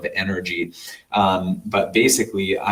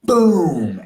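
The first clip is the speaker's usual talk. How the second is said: sudden loud, higher boom like an explosion; sudden loud boom as the cosmic snake strikes; emphatic boom; 7 semitones higher, 7 dB louder than usual. Retold or imitated. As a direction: punch it out as an explosion.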